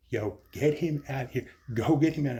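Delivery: shouted order. Retold or imitated. Doctor yells at security to get him out, loud order. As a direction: bark it at the guards to drag him out.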